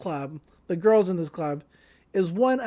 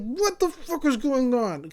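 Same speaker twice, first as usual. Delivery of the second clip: higher-pitched mock outrage; agitated demand; 5 semitones higher than usual. Girl shaking her clubmate for answers, agitated. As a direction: act out a frantic confrontation. Acted shaking and confronting him.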